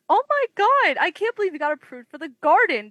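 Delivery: high, excited mock gush; gushing friend. Retold best friend's excited reaction.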